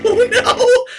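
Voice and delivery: high, loud panicked yelps breaking into laughter; real panic as the plane spins out of control, then cracking up; panicked screaming. Real, not acted. Plane crashing in the game.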